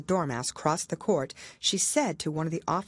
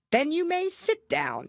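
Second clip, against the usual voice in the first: haughty king; 12 semitones higher than usual. Acted. King voiced.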